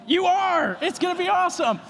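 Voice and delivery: a pastor reassuring a student, excited and high; excited reply. Retold or imitated. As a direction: hype it up, pitched high. Speaker's own enthusiastic reply.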